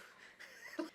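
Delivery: quick high laugh; high burst of laughter. Real, not acted. Laughing at the memory of the snake, high laugh. Laughs after story beat.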